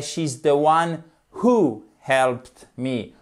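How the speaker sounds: who stressed, sentence said very slowly; slow example stressing who. Shown as a demonstration.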